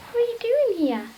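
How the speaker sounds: very high, loud pet voice; talking to the dog. Real, not acted. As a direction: squeal the question fondly at a pet. Talking playfully to her dog.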